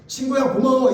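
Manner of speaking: grateful friend. Retold or imitated. Preacher voicing a grateful David to the congregation. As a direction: say thanks like a relieved friend. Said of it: "이래야 되잖아요".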